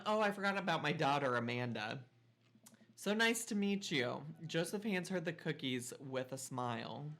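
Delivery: normal talking voice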